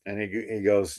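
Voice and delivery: ordinary delivery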